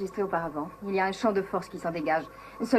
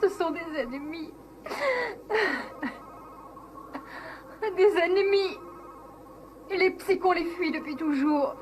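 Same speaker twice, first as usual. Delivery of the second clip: frightened; 8 semitones higher than usual. Acted. Maya terrified of the Dorcons.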